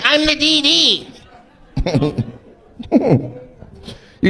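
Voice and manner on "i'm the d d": high, slow prim reply; mock prim designated driver; imitating her. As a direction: squeak it like the sober good girl. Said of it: "She's like".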